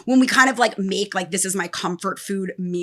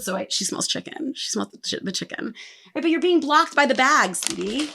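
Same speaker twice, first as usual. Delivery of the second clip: cooing to a pet; 4 semitones higher, 5 dB quieter than usual. Real, not acted. Talking to her hungry pet.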